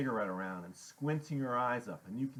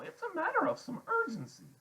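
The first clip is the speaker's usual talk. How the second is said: put-on formal but very high kid voice; trying to sound grown-up. A narrator's reading. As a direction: attempt adult dignity as the voice squeaks up. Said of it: "make his voice sound deep and more grown".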